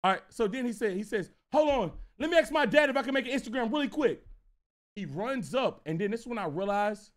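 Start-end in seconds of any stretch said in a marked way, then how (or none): 1.5-4.2 put-on fast, higher-pitched excited kid; hurried, eager kid voice. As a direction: rush it out before the chance slips away. Acting excited kid hurrying off.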